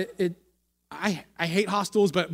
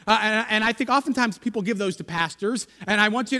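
ordinary talk

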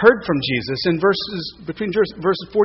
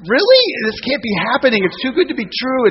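astonished; 6 semitones higher, 3 dB louder than usual. Retold or imitated. Astonished disbelief quote.

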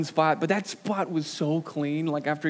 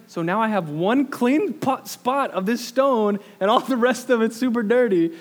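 wife's dry rebuttal; 7 semitones higher, 7 dB louder than usual. Retold or imitated. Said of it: "And she was like".

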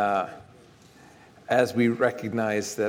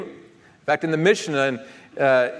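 ordinary delivery

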